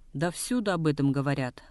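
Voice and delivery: usual speaking voice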